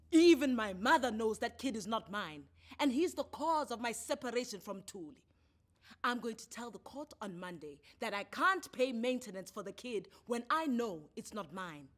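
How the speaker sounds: loud, stubborn insistence; insistent anger. A narrator's reading. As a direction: insist hard and loudly. Tammy insists angrily.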